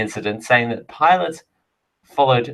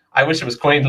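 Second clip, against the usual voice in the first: ordinary talk, nothing marked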